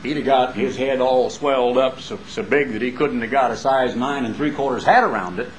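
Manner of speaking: ordinary delivery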